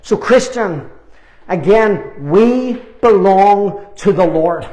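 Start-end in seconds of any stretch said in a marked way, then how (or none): none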